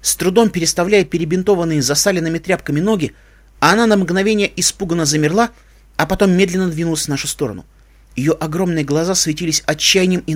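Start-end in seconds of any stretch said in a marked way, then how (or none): none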